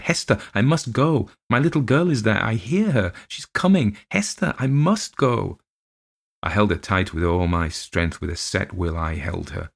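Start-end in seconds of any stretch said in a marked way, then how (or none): 0.0-5.6 put-on high, pleading child's cry. Little girl begging her nurse to let her go, high pleading cry. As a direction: beg to be let go, voice pitched up.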